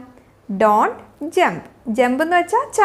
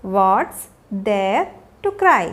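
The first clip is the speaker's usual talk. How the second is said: slow, broken into separate pieces; word by word; 6 semitones lower, much slower than usual. Shown as a demonstration. Teacher showing learners a question for a crying child, word by word. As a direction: pause after "What's" and after "there".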